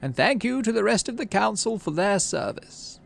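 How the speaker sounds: governor Udicott's voice. Acted. Udicott thanking the council graciously.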